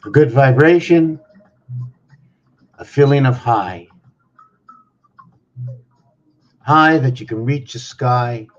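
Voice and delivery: loud spoken-word poem, pausing between phrases; poem recitation. Acted. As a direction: proclaim each phrase like a verse. Opening recited poem lines.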